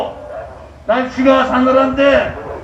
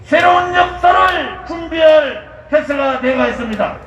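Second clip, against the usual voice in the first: roaring rallying speech; 4 semitones higher than usual. Acted. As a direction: rouse the crowd like a rally leader. (군중을 선동하는 우렁찬 연설)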